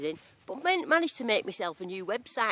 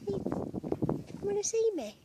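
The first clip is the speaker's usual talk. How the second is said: cooing to goats; slower than usual. Real, not acted. Greeting the baby goats, high sing-song baby talk. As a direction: sweet-talk the little goats.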